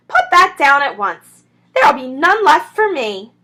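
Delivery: loud shrieking rat. Acted. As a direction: screech it like a greedy rat. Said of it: "shrieked Rat".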